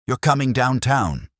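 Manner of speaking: loud and high, grabbing the suspect; forceful arrest. Acted. Kramer seizing Bell by the shoulder, loud and forceful. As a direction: bark it as you collar him.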